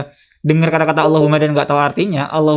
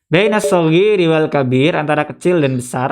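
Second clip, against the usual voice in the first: usual speaking voice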